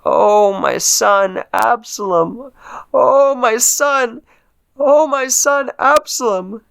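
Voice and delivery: slow, high, broken wail of grief; grieving father wailing over his dead son; weeping lament. Acted. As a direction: cry out for the lost son. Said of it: "so he said as he wept".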